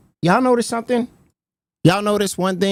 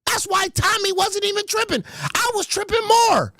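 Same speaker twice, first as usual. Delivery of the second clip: high, half-shouted disbelief; excited realization; 11 semitones higher, 4 dB quieter than usual. Real, not acted. Host realizing Tommy C's trick, high gleeful disbelief. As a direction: build up the gleeful revelation.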